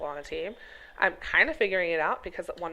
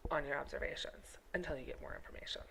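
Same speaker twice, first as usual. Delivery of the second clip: whispered advice; 6 semitones lower, 13 dB quieter than usual. Real, not acted. Drops to a whisper.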